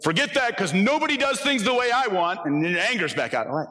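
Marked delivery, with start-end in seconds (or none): 0.0-2.4 mock frustration. Angry person giving up on change, high and exasperated. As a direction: blurt it out like someone fed up. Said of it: "you're like".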